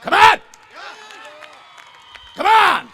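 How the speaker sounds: loud, high shout to the crowd; rousing call. Real, not acted. Preacher firing up the congregation, loud rousing call. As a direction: rouse the congregation.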